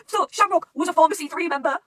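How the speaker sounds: loud, very high-pitched outburst; shocked disbelief. Real, not acted. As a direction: shriek it, stunned by a plot twist. Shocked by the book's twist.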